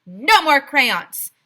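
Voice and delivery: loud, slow flat no; mayor bluntly refusing; firm refusal. A narrator's reading. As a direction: lay down the law. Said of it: "said Mayor Hubble".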